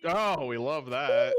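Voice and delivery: loud, slow, delighted drawl; excited drawn-out. Real, not acted. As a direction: stretch it out, thrilled. Delighted at a good roll.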